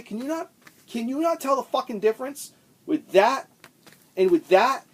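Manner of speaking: high, loud exasperated rant; furious rhetorical questions, rising; angry rant. Real, not acted. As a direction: pitch up in disbelief at their stupidity. Ranting about backwards records.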